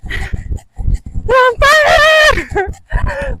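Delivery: long drawn-out shout, out of breath; pained shout. Real, not acted. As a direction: cry it out while running. Legs burning while running.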